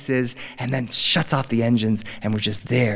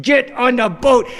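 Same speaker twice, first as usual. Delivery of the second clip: tense, higher-pitched exasperated outburst; exasperated yell; 10 semitones higher, 4 dB louder than usual. Acted. Frazzled dad hurrying family to the boat, exasperated yell. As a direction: urge them on, at the end of your patience.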